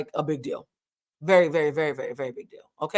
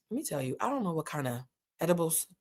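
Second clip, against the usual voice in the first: usual speaking voice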